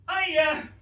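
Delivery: high, loud cheery greeting; calling out. Retold or imitated. Boy shouting hello to the mourners from the car.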